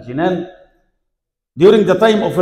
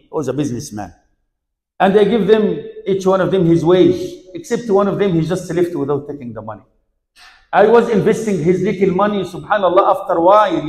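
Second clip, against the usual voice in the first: usual speaking voice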